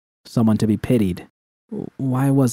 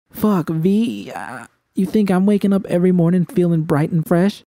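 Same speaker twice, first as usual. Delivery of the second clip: V pitched higher, hurt and angry; V, upset and defensive; 8 semitones higher than usual. A narrator's reading. V to Judy, hurt and lashing out, high voice. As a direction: fire it back at her through tears. V's voice held in narration.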